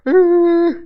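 sudden high yelp of alarm; high startled cry; startled grunt. Retold or imitated. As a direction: blurt a shocked squawk. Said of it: "and he's like".

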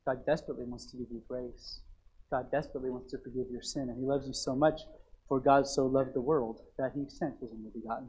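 hushed altar-call tenderness; intimate quiet preaching. Acted. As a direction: lean in and plead tenderly. Intimate quiet appeal, scripture.

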